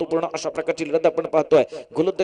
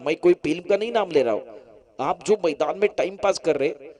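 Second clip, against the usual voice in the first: ordinary talk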